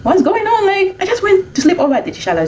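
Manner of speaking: put-on little girl to worried family, high and puzzled; puzzled child. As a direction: play the carefree little girl who doesn't get the fuss. Child brushing off adults' worry.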